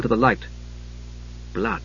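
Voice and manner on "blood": barely spoken, hushed; softly. A narrator's reading. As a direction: breathe the word. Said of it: "he said softly".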